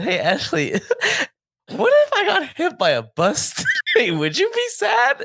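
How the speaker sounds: can barely get the question out for laughing; laughing through the question. Retold or imitated. Retelling asking Ashley a morbid question, laughing throughout. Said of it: "I said".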